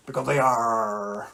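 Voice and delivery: slow, drawn-out pirate "arr"; long pirate-style final word; pirate joke punchline. Acted. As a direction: stretch ARE into a pirate growl.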